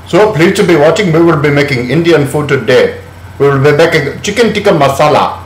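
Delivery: put-on playful Indian accent for a cooking-show greeting; mock Indian accent. Host greets cooking-show viewers in a mock Indian accent. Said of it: "does my little Indian accent offend you".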